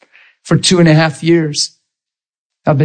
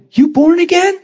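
high-pitched squeal of disbelief; retold incredulous squeak; amazed question. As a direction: ask it in mock astonishment, way up high. Acts out amazed question to coworker.

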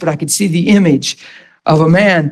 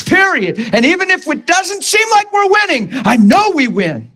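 much higher, firm preaching with NOT hit hard; determined declaration; 8 semitones higher than usual. Acted. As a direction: stress on NOT, higher and rising. Acted resolute answer to God.

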